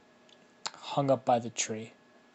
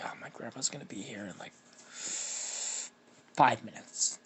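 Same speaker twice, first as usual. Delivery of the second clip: muttering to himself; 4 dB quieter than usual. Acted. Ben weighing it up to himself.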